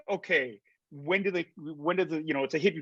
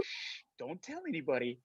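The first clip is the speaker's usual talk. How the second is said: hushing; 10 dB quieter than usual. Retold or imitated. Crew hushes him on set.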